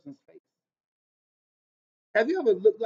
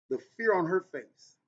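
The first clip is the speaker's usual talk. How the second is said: ordinary talk, nothing marked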